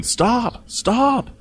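high-pitched mock scream; imitated screaming. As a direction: mimic a movie character screaming. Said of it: "someone keeps screaming".

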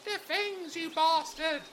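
put-on high, soft, grumbling old gnome; a soft grumble from Ola as the guests leave.

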